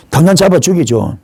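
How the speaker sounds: ordinary talk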